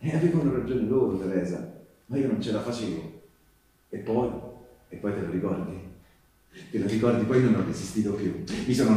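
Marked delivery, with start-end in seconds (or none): none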